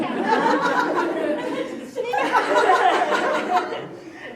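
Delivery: long loud, high burst of laughter; long loud laughing fit. Real, not acted. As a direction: let a big laugh roll out. Laughing at her own retort.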